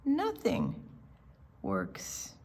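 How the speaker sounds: ordinary delivery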